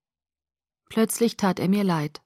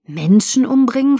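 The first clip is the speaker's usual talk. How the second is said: higher, louder shocked question; startled; 6 semitones higher, 6 dB louder than usual. A narrator's reading. Jacob jolts upright at Bella's words, startled. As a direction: echo it in wide-eyed disbelief. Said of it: "Augenbrauen zuckten hoch und seine Augen".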